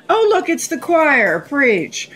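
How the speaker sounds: high-pitched sarcastic exclamation; mock delight. Diner mocks the waiter's play on fake call, sarcastic.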